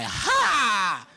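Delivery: loud, high showman's yell; a cocky stage shout; triumphant shout. Retold or imitated. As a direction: bark it like a rock star. Throwing shirt to audience.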